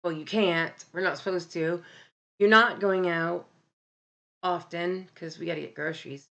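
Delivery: ordinary delivery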